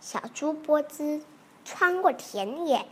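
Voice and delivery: ordinary delivery, nothing marked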